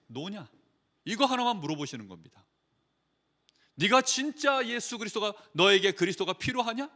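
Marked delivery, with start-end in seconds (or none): none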